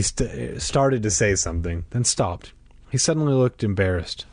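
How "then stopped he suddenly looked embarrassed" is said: ordinary delivery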